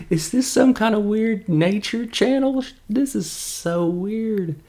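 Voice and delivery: very high mock bewilderment; weirded out. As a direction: squeal it as if you can't believe your eyes. Acted baffled reaction to scene.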